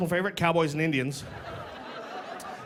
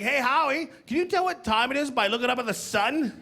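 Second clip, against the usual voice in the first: put-on higher-pitched curious kid question; lightly voiced, high kid voice; childhood friends; 7 semitones higher than usual. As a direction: imitate naive kids asking a pal. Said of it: "They'd be like".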